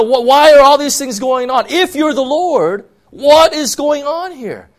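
high, loud, pleading cry to God; fervent loud complaint prayer; preached lament. Acted. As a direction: shout your frustration up at heaven. Crying out to God in frustration.

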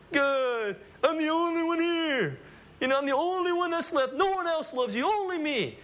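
high, whining plea of a self-pitying prophet; whiny complaint to God. Retold or imitated. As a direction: whine it like a sulking man talking to God. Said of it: "he's complaining to God".

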